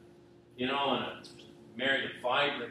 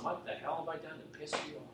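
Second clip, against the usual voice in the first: softer, faster, muttered under the breath; a hushed, spent echo; quiet anguished repeat; 3 semitones higher, 9 dB quieter, faster than usual. Retold or imitated. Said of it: "I meant it".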